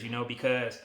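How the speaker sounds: usual speaking voice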